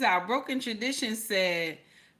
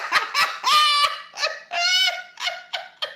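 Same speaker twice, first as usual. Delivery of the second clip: cackling laugh. Real, not acted. Cracking up at own joke.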